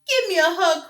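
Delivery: high, loud bear calling out; crying out. A narrator's reading. Douglas calling to the sheep, loud and high. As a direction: call after the fleeing sheep. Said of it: "cried Douglas".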